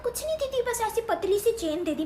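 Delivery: mimicking relative. Aunt imitates other woman.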